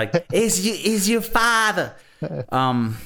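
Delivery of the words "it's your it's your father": high, slow, exaggerated New Yorker voice; New York accent. Mocking the movie's family, slow exaggerated New York accent. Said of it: "It's not New Yorker. It's like".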